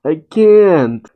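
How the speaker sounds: put-on high-pitched childish protest; Momonosuke whining. Overwhelmed child protesting he can't make flame clouds, whining. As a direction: put on a high, helpless whine. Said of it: "Momonosuke cries and whines".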